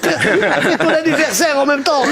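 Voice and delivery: slow, high, laughing; laughing while telling the date scene. Acted. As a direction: crack up mid-line. Laughing through punchline setup.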